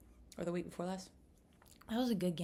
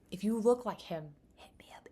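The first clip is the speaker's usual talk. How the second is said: breathy whisper, hit me up fading away; hushed, fading whisper; 2 semitones higher, 5 dB louder than usual. Shown as a demonstration. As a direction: whisper the invitation. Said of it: "repeated, whispered".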